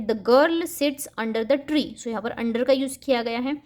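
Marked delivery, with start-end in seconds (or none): 0.1-1.8 very slow, word by word; slow, clear dictation pace; slow example read. Shown as a demonstration. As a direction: read it out slowly for children. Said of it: "यहाँ पर in positional word का use".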